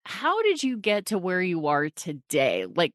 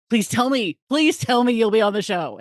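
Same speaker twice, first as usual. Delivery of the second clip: louder, emphatic re-enacted begging; pleading; 3 semitones higher, 5 dB louder than usual. Host retelling how she begged the guest onto the show, pleading. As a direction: beg him eagerly, hitting each "please".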